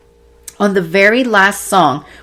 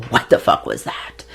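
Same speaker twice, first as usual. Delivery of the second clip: awed whisper; 6 dB quieter than usual. Retold or imitated. Taking off eye mask, stunned.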